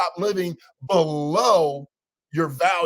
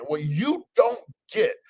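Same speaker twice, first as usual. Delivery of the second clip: ordinary talk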